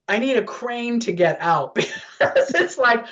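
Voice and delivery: laughing. Real, not acted. Joking about her big size.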